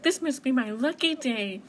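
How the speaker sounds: delighted. Acted. Mouse finding four apples, delighted. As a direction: let the delight lift your voice.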